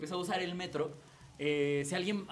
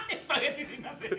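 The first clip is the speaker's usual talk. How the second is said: laughing; 9 semitones higher, 4 dB quieter than usual. Real, not acted. Laughing repeat.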